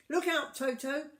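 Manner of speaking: high, loud call of alarm; urgent shout; shouted warning. A narrator's reading. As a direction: yell the warning to the children. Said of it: "shouted Humpy Rumpy".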